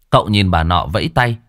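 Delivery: ordinary delivery, nothing marked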